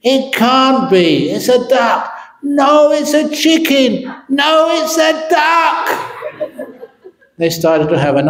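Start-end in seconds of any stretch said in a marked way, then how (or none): 0.0-2.1 higher, slow, insistent; arguing. Acted. Acted argument, husband. 2.4-5.8 higher and higher to a shout; both sides yelling over each other; escalating quarrel. Acted.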